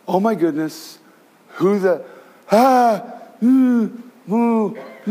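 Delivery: mock terror. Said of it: "that great fear".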